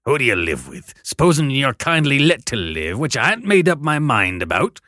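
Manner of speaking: loud rough convict threat; threatening convict. Acted. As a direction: menace the boy.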